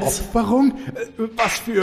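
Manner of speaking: shocked disbelief. Acted. Shocked at word sacrifice.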